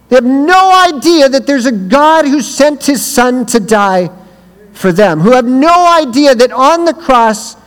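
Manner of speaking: fiery youth-rally preaching; retold preaching. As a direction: preach with fire. Preaching at full force.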